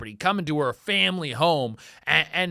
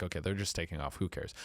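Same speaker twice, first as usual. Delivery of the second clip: much softer, low and calm; calm shrug; 10 semitones lower, 12 dB quieter, faster than usual. Retold or imitated. Homeowner watching through the blinds, soft and calm. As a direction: let it go with a quiet sigh. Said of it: "And you're like".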